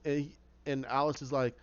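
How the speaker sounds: ordinary delivery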